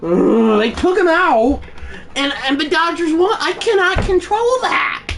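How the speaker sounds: high-pitched exasperated protest; furious. Real, not acted. Gamer raging because they took out his player, furious. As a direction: wail it high, a real outburst. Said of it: "I am furious right now".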